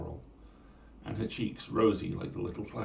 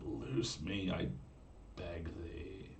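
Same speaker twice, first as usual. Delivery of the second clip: soft, sweet pleading mermaid; 9 dB quieter, much slower than usual. Acted. Mermaid sweetly begging Conchobar to free her, soft. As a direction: plead gently, soft as music. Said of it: "in a voice as sweet as".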